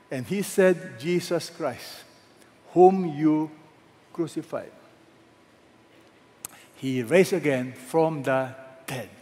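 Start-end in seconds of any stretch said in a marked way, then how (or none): 1.0-4.7 "whom you" pressed hard, "crucified" sinking to a hush; emphasis on crucified. Shown as a demonstration. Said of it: "Notice the emphasis".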